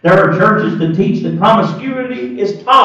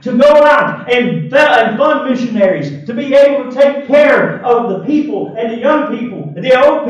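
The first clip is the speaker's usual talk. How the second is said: higher, louder shouting preacher; fiery preaching; 8 semitones higher, 4 dB louder than usual. Acted. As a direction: build to a full-force pulpit cry. Preacher climbs to full force.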